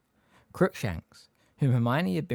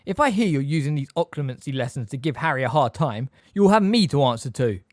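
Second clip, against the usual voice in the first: loud, higher warning building in force; threatening; 4 semitones higher, 8 dB louder than usual. A narrator's reading. Sirius warns Snape about mistreating Harry, threatening. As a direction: warn him off forcefully.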